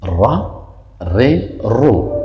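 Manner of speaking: "را، ری، رو" as ra, ri, ru in turn; three short vowels. Shown as a demonstration. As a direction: drill "را، ری، رو", changing only the vowel. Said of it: "زبر زیر و پیش". («را، ری، رو» با زبر، زیر و پیش)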